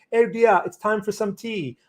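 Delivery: high, hammed-up British accent, a bit off; a jokey London accent put on for a viewer; mock British accent. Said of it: "I have to do my, my British accent".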